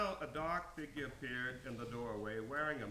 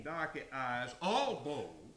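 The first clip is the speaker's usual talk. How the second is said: ordinary delivery, nothing marked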